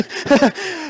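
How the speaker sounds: short, high-pitched laugh. Real, not acted. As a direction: laugh at your own anecdote. Laughs at own anecdote.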